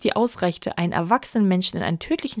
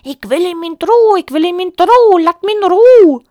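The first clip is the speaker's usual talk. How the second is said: high, loud cackling goose scold; 11 semitones higher, 11 dB louder than usual. A narrator's reading. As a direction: chatter it angrily like a goose. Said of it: "ein schnatterndes Schimpfen". (schnatternd schimpfende Gans)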